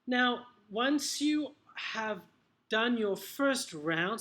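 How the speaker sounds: ordinary talk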